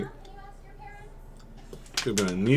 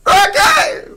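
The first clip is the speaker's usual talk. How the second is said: much higher, loud yelled call from afar; shouted call; 12 dB louder than usual. Retold or imitated. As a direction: yell it down the street. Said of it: "I hear".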